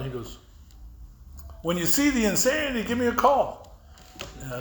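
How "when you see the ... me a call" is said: high, retold parting call; imitated call across a distance. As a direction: toss advice back from the car. Parting shout from the car.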